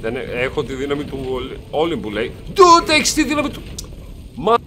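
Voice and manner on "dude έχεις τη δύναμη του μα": much higher, louder incredulous Dude; mocking disbelief. Gamer scoffing at Hermes' speed boast, high mock outrage.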